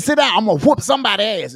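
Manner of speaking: loud, high "Sit down somewhere"; mom's yell. Retold or imitated. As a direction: bark it like an angry mom. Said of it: "I said".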